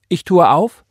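loud, high scriptural declaration; fervent proclamation. Acted. Church sister quoting God's word to the others, proclaiming. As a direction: proclaim it like a preacher.

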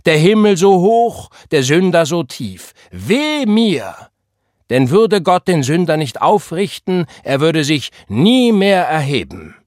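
rising revival preacher, calling out to the church; preaching. Acted. Gabriel preaching to the congregation, fervent revival sermon. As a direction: preach it at full force to a packed congregation.

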